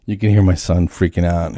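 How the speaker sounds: usual speaking voice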